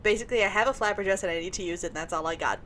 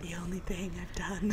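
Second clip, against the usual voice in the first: imitating whisper; 10 dB quieter than usual. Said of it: "You were like".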